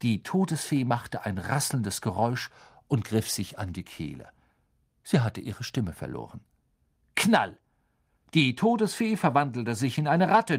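plain reading voice

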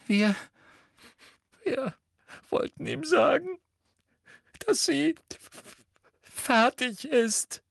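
very high, halting, tearful voice; choking it out. A narrator's reading. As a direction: force out each word between gulps. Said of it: "würgte Hermine hervor".

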